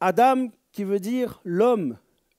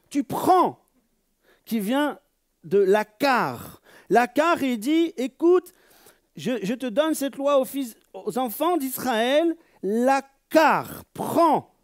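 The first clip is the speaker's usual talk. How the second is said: high, loud divine command; solemn preached decree; preaching voice; 8 semitones higher, 5 dB louder, slower than usual. Acted. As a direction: proclaim it as God's own law. Voicing God's command.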